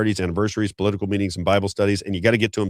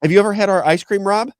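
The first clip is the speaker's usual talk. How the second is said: higher, sing-song imitation of the server; imitating Brenda; 11 semitones higher, 4 dB louder than usual. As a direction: lightly voice the friendly server. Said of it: "she goes".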